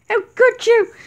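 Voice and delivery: high, loud, appalled demand; outraged accusation. Acted. Friend confronting Thomas, high and outraged. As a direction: hurl it at the killer.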